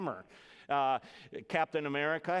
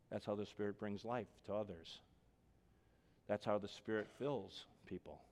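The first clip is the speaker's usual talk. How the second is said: soft closing reflection; 5 semitones lower, 9 dB quieter than usual. Acted.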